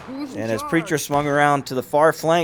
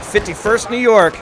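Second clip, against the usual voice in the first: higher and louder, calling the unit in; excited call; 8 semitones higher, 7 dB louder than usual. Real, not acted. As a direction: shout the unit's name as they charge. Calls the same unit, now excited.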